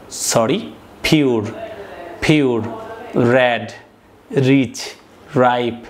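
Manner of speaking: each English adjective said alone, slow and clear, with a falling end; careful word pronunciation. Shown as a demonstration. Teacher reading vocabulary list aloud to students, careful model pronunciation. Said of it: "শুদ্ধভাবে উচ্চারণ করছি".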